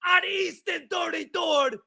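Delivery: mock New York caller, disgusted; New York caller rant.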